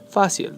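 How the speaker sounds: fácil stressed on FÁ, falling after. Shown as a demonstration. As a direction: hit the first syllable of fácil. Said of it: "The stress is over the, the A".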